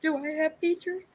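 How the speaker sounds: whiny mock crying; half-hearted fake sob. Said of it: "I'm doing the fake crying voice".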